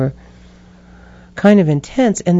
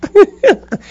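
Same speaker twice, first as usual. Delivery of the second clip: high, loud burst of laughter; real laugh; 7 dB louder than usual. Real, not acted. Storyteller amused at her own inner dialogue, loud real laugh.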